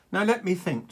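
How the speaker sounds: lightly voiced dragon, high and loud; a hint of a grand old dragon thinking aloud; mock-ponderous dragon; dragon musing. A narrator's reading.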